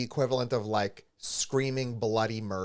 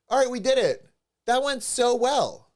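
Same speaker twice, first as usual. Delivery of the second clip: high, bright-voiced cheerful vet tech, a bit louder; chirpy vet tech; 9 semitones higher, 4 dB louder than usual. Vet tech breezily tells the worried owner it went well, cheerful mock voice. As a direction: mimic the tech's breezy all-is-well tone.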